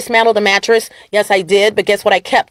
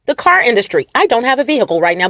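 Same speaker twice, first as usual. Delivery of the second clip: ordinary talk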